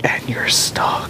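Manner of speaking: slow whispered and you're stuck; hushed slow deadpan whisper; whispered aside. Retold or imitated. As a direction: breathe it out slowly, deadpan.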